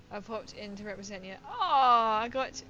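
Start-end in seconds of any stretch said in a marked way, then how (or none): 1.4-2.6 delighted. Real, not acted. Thrilled at viewer's visit.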